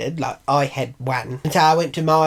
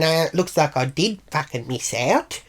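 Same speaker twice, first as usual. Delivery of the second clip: usual speaking voice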